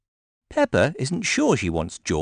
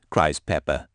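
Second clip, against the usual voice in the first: plain reading voice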